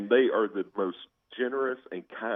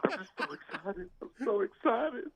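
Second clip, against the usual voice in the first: put-on high, giddy voice of his young daughter; dad imitating his daughter gushing after the game; excited little daughter. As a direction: squeal it like a thrilled kid. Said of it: "She's like".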